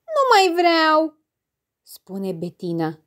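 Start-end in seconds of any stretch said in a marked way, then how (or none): 0.1-1.2 put-on high, slow childish refusal; a little girl pushing her plate away; slow, high pouting child; sulky child. Child figure refusing food.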